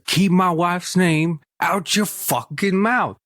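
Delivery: slow, high, drawn-out mock yell; hammed-up Will Smith snapping; slow high parody of an angry celebrity; imitating Will Smith. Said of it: "that whole thing with the".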